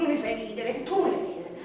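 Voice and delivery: taunting while laughing; mocking him through a laugh; laughing mockery. Mocking the defendant.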